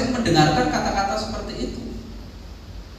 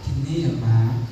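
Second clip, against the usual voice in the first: much lower and slow, put-on softness; forced gentle voice; 10 semitones lower, slower than usual. Shown as a demonstration. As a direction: say it like wearing a mask of politeness. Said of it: "Harusnya harus pakai topeng".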